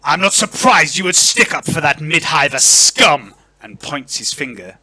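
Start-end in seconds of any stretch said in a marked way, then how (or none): 0.0-3.3 loud, scornful lord; angry outburst with raised pitch. Acted. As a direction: spit contempt at an inferior, loudly. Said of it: "Lord Verence's voice raises".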